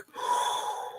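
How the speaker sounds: a quick breathy intake of surprise; sharp moved gasp. Retold or imitated. Speaker hearing the co-host credit her, gasping. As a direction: catch your breath, touched.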